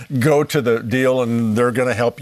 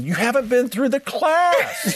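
inner protest; 9 semitones higher, slower than usual. Retold or imitated. Retold inner thought.

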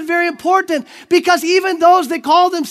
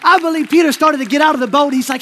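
ordinary talk, nothing marked

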